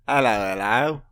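loud, laughing British bobby voice; British policeman accent. Acted. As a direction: call it out like a cheery English bobby with a British accent. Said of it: "hated how well you did that".

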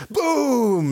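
sudden high "Boom!" to scare a friend; mock boom. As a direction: pop it out high and sharp, a playful scare. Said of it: "like this".

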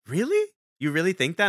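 a startled, delighted really; high, amazed question; surprised. Retold or imitated. Ryan surprised by compliment.

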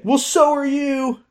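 high, slow mock-prissy mom voice; mock Kathleen. Kathleen firing back at her daughter, high and slow mocking voice. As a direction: squeak it back like an offended mother. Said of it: "Kathleen's like".

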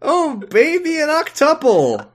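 high, whooping celebration; mock gamer hype. Mock gamer celebrating a multi-kill.

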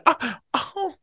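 high, slow mock flustered apology; hammed-up flustered high apology. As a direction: ham up a flustered Oh oh. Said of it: "No, I'm kidding".

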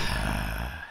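long, low exasperated sigh; frustrated sigh. Real, not acted. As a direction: let out a real sigh of frustration. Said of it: "That's so frustrating".

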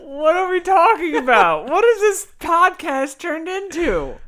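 high, loud, drawn-out disbelief; incredulous. Real, not acted. Podcaster appalled by co-host's question, loud incredulous outcry.

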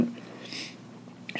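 words between sips of Coke; while sipping a drink. Real, not acted. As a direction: talk with a drink at the lips. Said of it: "Sorry, that's my Coke".

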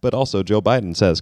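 normal talking voice

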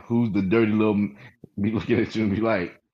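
ordinary delivery

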